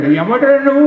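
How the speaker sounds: put-on very high startled old man's voice; old man asking. Old man asks the fallen one who he is, high. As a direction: squeak it out like a frail old man. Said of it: "అంటే".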